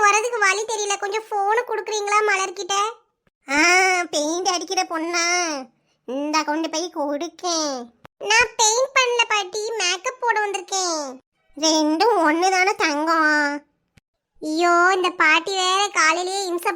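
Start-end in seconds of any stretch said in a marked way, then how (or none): 3.5-7.7 put-on old grandmother. Grandmother mishearing the caller. 11.6-13.3 put-on slow, lower, puzzled old granny on the phone; old grandmother.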